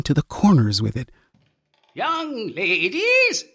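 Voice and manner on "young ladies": put-on high, slow, drawn-out old man's call; shrill cracked old man. A narrator's reading. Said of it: "in a shrill, cracked voice".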